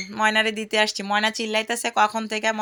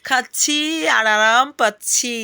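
teasing relish; 3 semitones higher, 6 dB louder, slower than usual. Real, not acted. Teasing the bird while eating.

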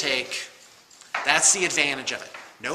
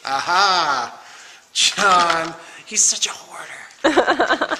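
teasing aha; 3 semitones higher, 6 dB louder, much slower than usual. Real, not acted. Teasing coworker John.

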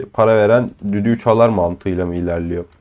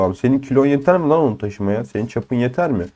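normal talking voice

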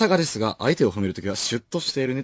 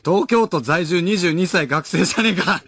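high, loud comic comeback; exclaimed mock indignation; mock-outraged retort; 7 semitones higher, 7 dB louder than usual. Real, not acted. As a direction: call out the prank with a raised voice. Mock outrage at a joke mail.

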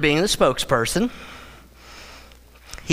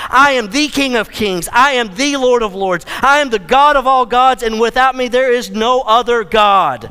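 emphatic Jesus; 4 semitones higher, 4 dB louder than usual. Acted. Jesus declares to his apostles he will conquer death, loud and emphatic. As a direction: proclaim it like a king declaring victory. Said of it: "Jesus is being emphatic".

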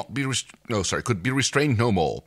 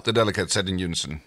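normal talking voice

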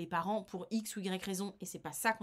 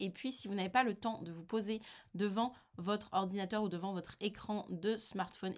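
usual speaking voice

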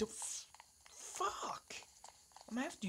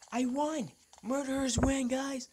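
loud, high triumphant yell; gloating victory shout; 7 semitones higher, 8 dB louder than usual. Real, not acted. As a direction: shout the win out. Winning the round.

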